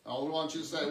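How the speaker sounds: ordinary talk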